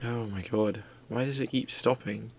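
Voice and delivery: ordinary talk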